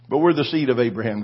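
ordinary delivery

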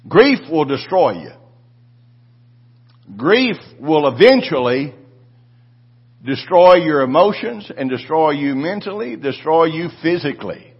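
forceful preaching. Acted. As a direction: hammer it home to the congregation. Preaching on grief, full force.

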